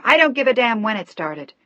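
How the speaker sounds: loud, sharp retort; fired at him. A narrator's reading. Daughter snapping at her pleading father, angry. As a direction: fire it back. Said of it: "She fired the words at him".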